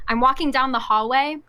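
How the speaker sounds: ordinary talk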